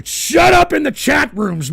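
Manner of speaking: high, loud, drawn-out order; sudden exasperated outburst; angry shout. Real, not acted. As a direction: silence the chatters with a yell. Snaps at noisy chatters.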